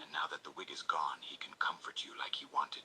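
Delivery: soft whispered storytelling; whispered narration. A narrator's reading. Narrator telling the listener's story, whispered. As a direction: whisper the narration close to the ear.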